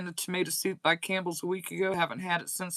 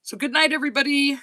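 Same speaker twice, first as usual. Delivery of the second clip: cheery sign-off; 7 semitones higher, 6 dB louder, faster than usual. Real, not acted.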